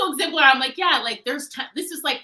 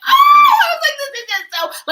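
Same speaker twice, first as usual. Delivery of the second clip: very high, loud squealing gush; overwhelmed squeal; 15 dB louder than usual. Real, not acted. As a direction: squeal over it, overcome. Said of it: "I'm like".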